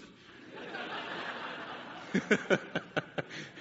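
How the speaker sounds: breathy burst of laughter; helpless laugh at the memory; big unvoiced laugh. Real, not acted. Laughing at Mickey ears story.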